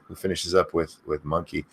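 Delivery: normal talking voice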